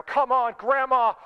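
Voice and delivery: retold yell. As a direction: re-enact yelling at a slow driver. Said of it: "I remember just yelling inside of my car".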